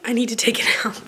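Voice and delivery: sore-throat whisper. Real, not acted. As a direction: whisper to spare the voice. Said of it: "it hurts so bad to talk".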